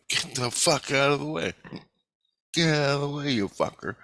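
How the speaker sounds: angry yell at driver. Retold or imitated. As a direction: snap at him to move. Yelling at a swerving driver.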